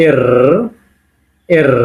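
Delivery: এর with a long rolled r, "errr"; pronunciation demo. Shown as a demonstration. Teacher naming the Russian letter, এর pronounced with a held r. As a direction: model এর, holding the r at the end. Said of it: "P এর মতো এটাকে বলবো".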